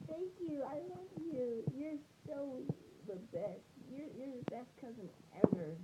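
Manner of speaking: put-on tiny high, soft child voice; squeaky-high mock kid gushing quietly; little cousin. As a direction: imitate a grateful little kid in falsetto. Acts the grateful little cousin.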